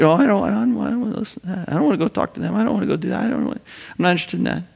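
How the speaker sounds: mildly hammed-up reluctance; reluctant refusal. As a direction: act out someone wriggling out of it. Acting out reluctant refusal to God.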